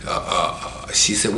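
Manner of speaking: ordinary talk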